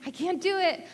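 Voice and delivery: retelling venting to a friend, loud high exasperation; exasperated college voice. Retold or imitated. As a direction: throw up your hands to a friend, loud and high. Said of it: "But I was like".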